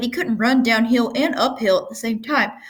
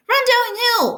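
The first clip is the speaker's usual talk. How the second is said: much higher, louder cow call; 4 dB louder, slower than usual. A narrator's reading. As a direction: urge him on like the cows. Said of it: "suggested the cows".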